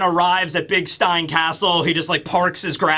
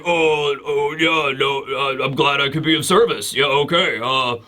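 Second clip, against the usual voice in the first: low, halting imitation of a flustered old man; mock-bashful, fumbling and low; smitten old man; 5 semitones lower than usual. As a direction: stammer bashfully in a lower voice. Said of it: "he's, like, a little smitten by Nami's charms".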